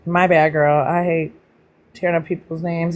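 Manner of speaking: usual speaking voice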